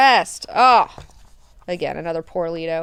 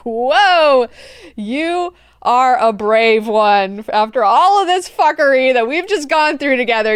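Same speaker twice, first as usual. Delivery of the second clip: mock grand sarcasm; 4 semitones higher, 7 dB louder than usual. Leto mockingly granting reward.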